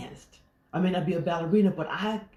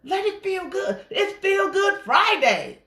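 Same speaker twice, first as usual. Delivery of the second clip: high, celebratory shout-out; high-pitched, rousing cheer building louder; exuberant cheer; 12 semitones higher, 6 dB louder than usual. Acted. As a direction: hype up the crowd for a party. Cheering on feel-good dancing.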